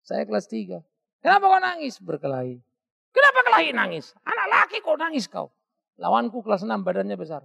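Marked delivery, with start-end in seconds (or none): 1.2-2.0 high, loud demanding father; stern father. Retold or imitated. Father confronts his crying son, loud and stern. 3.1-5.5 high, loud scolding father; stern father. Retold or imitated. Father scolds his son for crying after a fight, high and loud.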